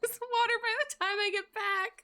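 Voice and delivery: very high, loud playful nagging; hammed-up high-pitched bossy order; mock scolding. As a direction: squeal it as a pretend threat. Mock scolding customers about water.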